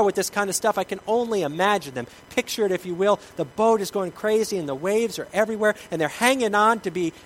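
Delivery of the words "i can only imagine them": ordinary talk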